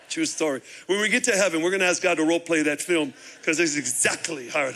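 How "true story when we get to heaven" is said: normal talking voice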